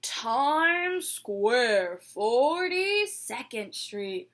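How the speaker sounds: loud, very slow announcer call; stop announcement. Pretend. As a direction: boom the station name like the PA.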